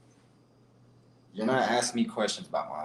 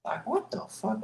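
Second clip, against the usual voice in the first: disbelief; 12 semitones higher, 5 dB quieter than usual. Real, not acted. Venting disbelief at the doubters.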